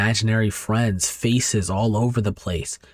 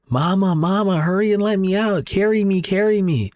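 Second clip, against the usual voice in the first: put-on high, thin toddler voice calling from below; eerie baby voice; 9 semitones higher than usual. A buried toddler calls up to her mother, high eerie baby voice. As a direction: sound like a ghostly two-year-old pleading. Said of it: "The voice of this is baby Remy".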